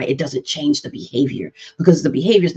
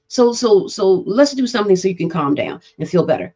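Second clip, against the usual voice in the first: normal talking voice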